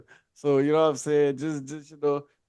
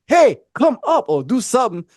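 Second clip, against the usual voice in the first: imitated loud, higher field call; 5 semitones higher, 4 dB louder than usual. Teammates yelling at him to come up, called out loudly.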